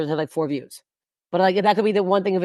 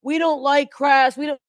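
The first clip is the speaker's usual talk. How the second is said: slow, higher, prissy mock-disapproval; mock prim Canada; 7 semitones higher than usual. Mocking Canada's squeamish attitude, slow and higher. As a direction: sneer it like a stuffy prude. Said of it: "why Canada's like".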